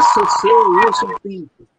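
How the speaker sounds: wrong form said loud. Shown as a demonstration. Teaching the club members what not to say, loud. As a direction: stress the wrong "C C" loudly as a warning. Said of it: "Você não fala".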